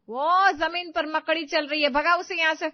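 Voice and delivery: high, loud command from a mother-in-law; bossy order. Acted. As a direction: order her loudly to deal with it. सास बहू को हुक्म देती है. (ऊँची आवाज़ में हुक्म)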